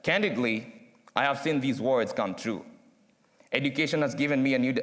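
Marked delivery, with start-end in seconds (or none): none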